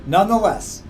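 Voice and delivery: plain reading voice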